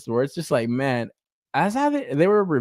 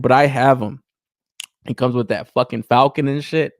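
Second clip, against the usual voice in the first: ordinary talk, nothing marked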